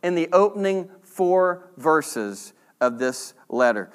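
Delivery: ordinary talk, nothing marked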